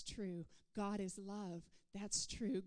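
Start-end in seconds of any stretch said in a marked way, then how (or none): none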